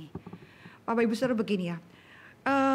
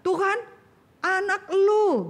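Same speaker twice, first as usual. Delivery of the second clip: exasperated blaming; 9 semitones higher, slower than usual. Acted. Parent blaming spouse over child.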